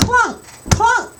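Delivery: very high, loud clunks; clunk sound. Shown as a demonstration. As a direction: clunk it out for the kids. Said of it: "Let's try it again".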